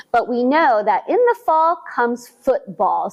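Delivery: ordinary delivery, nothing marked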